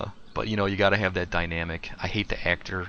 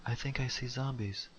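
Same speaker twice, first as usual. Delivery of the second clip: softer, higher, naive little voice; naive sensitive guy; 5 semitones higher, 6 dB quieter than usual. Retold or imitated. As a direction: play him as a clueless innocent, softly. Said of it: "he's gonna be like".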